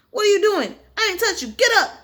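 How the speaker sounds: high, loud indignant demand; mock angry fighter. Fighter yelling at a fallen fake opponent, indignant. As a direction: bark at him to get up.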